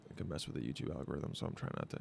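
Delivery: hushed whisper aside. Real, not acted. Worried about the YouTube algorithm, whispered aside. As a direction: whisper it like a secret.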